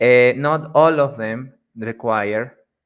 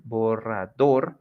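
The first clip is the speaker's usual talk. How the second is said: "borrador" slowly split as bo-rra-DOR; stress on dor; slower than usual. Shown as a demonstration. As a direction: stretch "borrador", lifting the final dor. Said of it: "even though it's dor".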